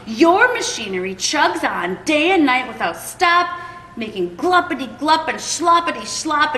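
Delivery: an indignant creature scolding the factory owner; riled-up Lorax. Acted. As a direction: lecture him with your dander up. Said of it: "his dander was up".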